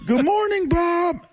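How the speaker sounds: high squawky parrot greeting. Retold or imitated. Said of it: "Every morning".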